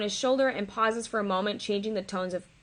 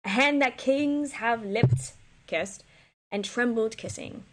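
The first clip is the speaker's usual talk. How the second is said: slow dramatic reading; 2 semitones higher, slower than usual. Acted. Reading Cleopatra's lines.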